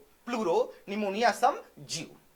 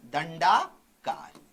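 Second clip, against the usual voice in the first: "दंडाकार" stretched out, lower, rising on "दंडा"; slow dictation; 5 semitones lower, much slower than usual. Shown as a demonstration. Teacher dictates a term to students, "दंडाकार" slowly stretched. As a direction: draw "दंडाकार" out in two slow parts.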